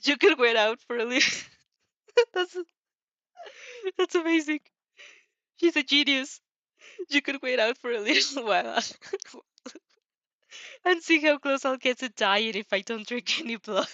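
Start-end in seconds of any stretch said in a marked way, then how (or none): 1.8-6.4 laughing through the words, higher and louder; can't stop laughing at the joke. Real, not acted. Reader laughing at the chat's idea, laughing hard. 7.1-13.9 reading through bursts of laughter; barely holding it together; laughing. Real, not acted. Reader reading a viewer's suggestion aloud, giggling through it.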